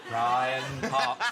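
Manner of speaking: imitating the TV professor saying his own name; lightly voiced Professor Brian Cox; Brian Cox impression. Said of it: "the one who goes about".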